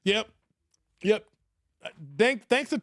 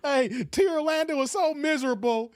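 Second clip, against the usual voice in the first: ordinary talk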